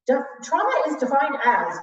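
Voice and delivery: a mild mock professor reciting definitions; stiff academic. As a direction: read it out like a dry textbook. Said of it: "as any academic does".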